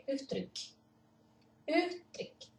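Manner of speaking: "uttryck" with a quick rounded u before the double t; short vowel demo. Shown as a demonstration. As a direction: clip the vowel short and fast. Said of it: "duas consoantes".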